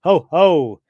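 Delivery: loud, high gloating "ho ho" laugh; a cartoon villain's gloating chuckle. Mister Mind gloating over Earth, loud villain laugh.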